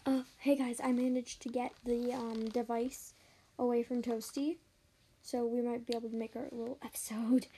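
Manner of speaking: hushed sneaky whisper to camera. Acted. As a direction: whisper so nobody overhears. Sneaking device away, whispering.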